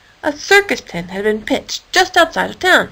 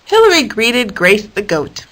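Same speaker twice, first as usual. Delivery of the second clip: usual speaking voice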